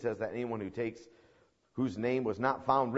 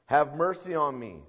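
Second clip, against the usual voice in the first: slightly louder, higher plea, slowing; half-raised pleading cry; mild acted yell; 4 semitones higher, 6 dB louder than usual. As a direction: call out for mercy without a full shout. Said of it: "And he yells out".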